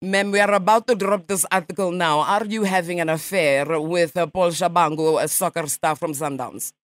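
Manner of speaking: a light mock Russian accent, nasal ma'am; radio prank caller in a lightly faked Russian accent; put-on Russian accent. Prankster calls a stranger as a Russian journalist, mock Russian accent. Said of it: "for a Russian, your English is quite good".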